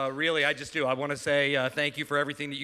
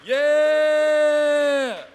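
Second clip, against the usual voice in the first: cheering shout; 10 semitones higher, 6 dB louder than usual. Real, not acted. Cheering the joke winner.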